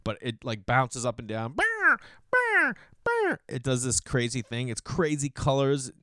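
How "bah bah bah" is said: very high, loud squawking bah; bird impression. Imitating a bird puffing up to mate, high loud squawks. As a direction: squawk like a puffed-up mating bird. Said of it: "It's a horrible impression".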